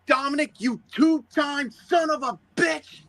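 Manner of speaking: high, drawn-out commentator outrage; Michael Cole. Retold or imitated. Commentator berating Dominic over the kiss, high mock outrage. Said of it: "kinda like the way Michael Cole did".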